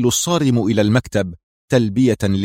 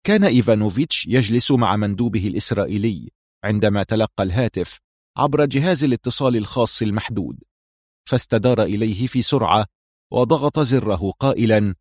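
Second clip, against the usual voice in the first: plain reading voice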